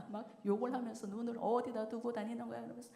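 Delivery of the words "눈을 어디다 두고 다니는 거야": usual speaking voice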